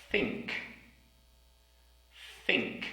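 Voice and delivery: Think, tongue between the teeth; careful th in think. Shown as a demonstration. Teacher drilling the word Think. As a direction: Think with a clear, unvoiced th. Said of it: "We also have".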